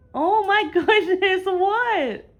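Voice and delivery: high, loud, drawn-out exclamation; big squealed fuss over a pet; exclaiming to a pet. Real, not acted.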